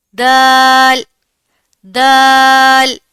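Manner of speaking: دال said loud and slowly drawn out; model letter dal. Shown as a demonstration. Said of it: "دال کا اخراج تا کے مخرج سے ہوتا ہے". (دال کی واضح ادائیگی)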